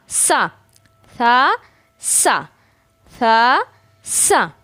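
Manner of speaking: सा said again and again with tongue on teeth; repeated sa drill. Shown as a demonstration. Teacher drilling the letter sa for students. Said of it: "ये बन जाएगा सा".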